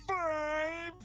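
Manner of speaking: high squeak. Acted. Counting squares upward.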